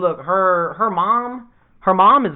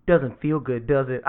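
smug low retort; 9 semitones lower, 6 dB quieter than usual. Retold or imitated. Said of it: "She looks over her shoulder".

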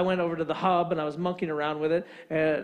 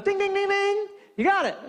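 high-pitched winner's bell, then announcement; game show host; 12 semitones higher, 3 dB louder than usual. Retold or imitated. Alarm man accepts The Godfather, game show ding. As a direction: ring out the correct answer like a quiz host. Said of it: "we're playing some sort of game show here".

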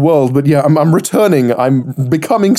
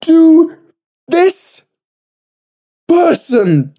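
stunned disbelief; 13 semitones higher, much slower than usual. Real, not acted. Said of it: "reacting in disbelief after the poem".

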